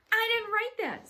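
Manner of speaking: loud, high-pitched protest; heated denial. Acted. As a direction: protest your innocence at full pitch. Girl denies writing an insult.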